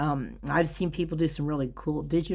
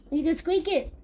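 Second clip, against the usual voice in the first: high coaxing pet voice; 13 semitones higher than usual. Real, not acted. Talking to her dog Daisy, high baby talk. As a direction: coax the dog to squeak.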